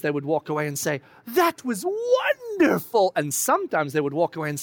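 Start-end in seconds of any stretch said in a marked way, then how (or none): 1.4-3.1 higher, drawn-out amazed praise; awed disciple. Retold or imitated. Disciple leaving Jesus' teaching, slow awed marvel. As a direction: marvel at it slowly, wide-eyed.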